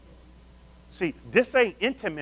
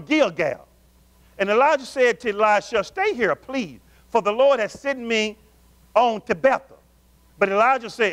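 preached Bible reading, raised and slowed in places; fervent scripture reading; 4 semitones higher, 4 dB louder than usual. Acted. Preacher reading Kings to his congregation, fervent. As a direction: read the verses like a pulpit preacher.

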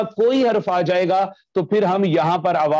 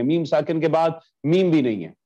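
ordinary talk, nothing marked

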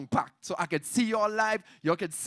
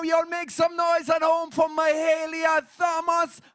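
loud, high, drawn-out hype; hype announcer; 7 semitones higher, 4 dB louder, slower than usual. Real, not acted. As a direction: whip up the crowd. Host hyping up performer.

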